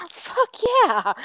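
very high-pitched imitated cheer; enthusiastic cheer. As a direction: mimic his thrilled approval way up high. Retells the actor's enthusiastic reply.

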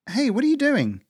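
high, loud startled call; alarmed challenge. Acted. Startled cry as partner runs off.

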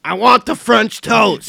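drawn-out, very loud high holler; slow it down and bellow the order; demanding customer yell. Acted. Acting out an insistent customer.

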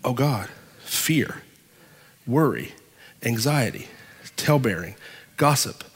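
low, hushed list with pauses; mock worried. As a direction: softly imitate someone sinking into worry. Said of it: "naturally turns to".

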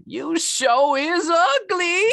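very high, slow put-on voice; mock character voice. Quoting a movie line.

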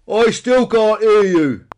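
very loud, high, drawn-out shout; escalated feigned deafness. A narrator's reading. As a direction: bellow slowly as if deafened.

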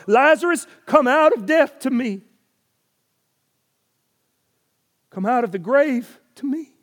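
loud, high summons to the dead; Jesus calling. Acted. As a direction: call across the grave with authority. Jesus calling Lazarus from tomb.

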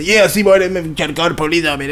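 fast, soft country grumble; country old man. Retold or imitated. Neighbor griping about the police, quick mutter. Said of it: "that's how he talks".

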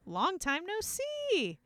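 high, slow, drawn-out greeting; a hammed-up high greeting; mock Narnians. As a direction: stretch it out sing-song. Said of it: "the Narnians are like".

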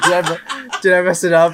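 laughing through a worried question; laughing mock panic. Retelling his own spelling panic, laughing. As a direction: laugh while asking. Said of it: "I was like".

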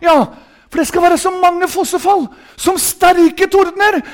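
high, stretched revival proclamation; impassioned high-pitched preaching; fervent preaching. Real, not acted. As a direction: proclaim the thundering sound excitedly. Preaches at full force.

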